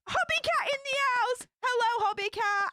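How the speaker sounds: big excited welcome for a favourite viewer; excited shout-out. Real, not acted. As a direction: call the name out with delight. Excitedly greeting a generous viewer.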